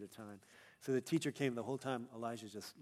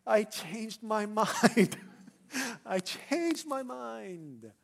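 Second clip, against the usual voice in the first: put-on very slow, high, loud child's wail; mock crying; 9 semitones higher, 9 dB louder, much slower than usual. Crying child answering teacher's reminder, mock bawling. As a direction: bawl it out like a preschooler, hammed up. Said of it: "And he's crying, crying".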